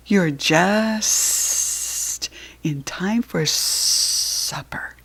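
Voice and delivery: slow, sly whispered snake voice; whispering snake. Acted. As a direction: hiss it out slowly like a sly snake. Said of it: "whispered the smooth, slithery snake".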